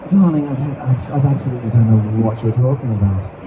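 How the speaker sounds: low, loud, haughty nonchalance; nonchalant. Shown as a demonstration. Bard answering a negative remark, nonchalant. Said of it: "could be very nonchalant".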